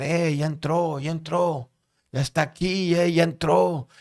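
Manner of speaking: high, mock yell of news; retold excited shout. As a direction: imitate calling out to the next cell, excitedly. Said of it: "Naún empezó a gritar".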